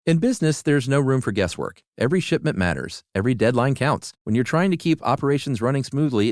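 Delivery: confident commercial announcer voice; ad read. Acted. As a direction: read it like a polished radio spot. Host reads USPS ad.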